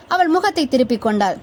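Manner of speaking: plain reading voice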